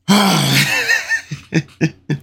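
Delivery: high laughing yelp of disbelief; exasperated laughing shout. Real, not acted. Vlogger reacting to the stacked West roster, laughing shout.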